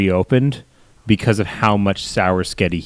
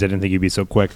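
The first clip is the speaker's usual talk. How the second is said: ordinary talk, nothing marked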